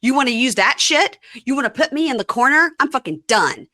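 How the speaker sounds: high, loud mock outburst; heated retold rant; very mad. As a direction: act out an angry husband standing his ground. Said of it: "Stands up very mad and says".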